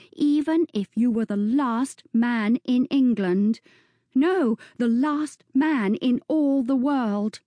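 put-on girl firmly refusing to marry the viscount; slow and distinct. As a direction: spell out the refusal word by word. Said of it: "She spoke slowly and distinctly".

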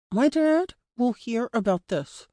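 mumbled through cloth. A narrator's reading. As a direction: protest through a gag, words blurred. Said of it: "I mumbled through the cloth".

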